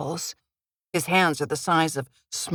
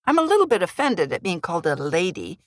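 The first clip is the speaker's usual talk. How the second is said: ordinary delivery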